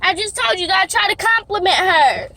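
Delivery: high and loud, hurt and furious; upset yelling. Real, not acted. Upset daughter yelling at parent who doesn't understand her. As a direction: lash out at the parent. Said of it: "You can cry and yell if you want".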